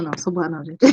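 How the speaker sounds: ordinary talk, nothing marked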